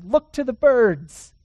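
loud, slow, ringing command. Shown as a demonstration. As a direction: call it out to the room. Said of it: "That's what he says".

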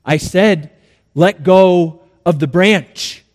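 slow, deliberate order; measured, patient insistence; insistent repeated command. Acted. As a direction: spell it out firmly, unhurried.

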